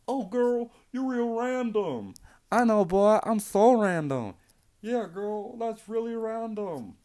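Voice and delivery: high, slow, mocking girly voices. Podcaster mocking random girls, high and slow. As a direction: lampoon them in a squeaky voice. Mock dialogue girl and boy.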